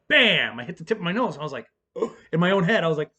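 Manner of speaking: ordinary delivery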